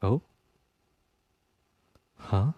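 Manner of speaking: normal talking voice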